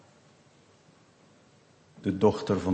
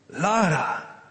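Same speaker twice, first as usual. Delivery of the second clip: high, helpless call down the crevice; distressed father; 10 semitones higher than usual. Acted. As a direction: call out, unable to reach your child. Helpless father calls down to daughter. (wanhopig roepende vader)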